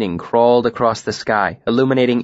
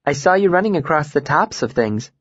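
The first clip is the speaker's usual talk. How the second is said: put-on light, girlish voice with a smile; smiling voice; 4 semitones higher than usual. A narrator's reading. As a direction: let a smile come through the words. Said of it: "I could hear a smile in her voice".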